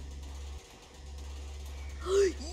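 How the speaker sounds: long, breath-only gasp; shocked gasp. Real, not acted. Streamer is startled by the game, gasping. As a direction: suck in air in shock.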